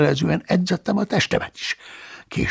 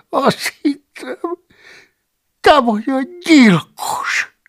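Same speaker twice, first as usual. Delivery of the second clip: much higher, slow, tearful admission; high tearful monk; 14 semitones higher, 5 dB louder, slower than usual. Acted. The cellarius admitting his fear to Paulus, tearful. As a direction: confess it near tears.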